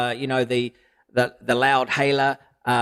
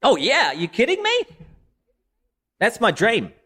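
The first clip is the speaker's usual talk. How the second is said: much higher, eager and thrilled; excited; 9 semitones higher than usual. Real, not acted. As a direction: gush about a dream come true. Excited answer about dream.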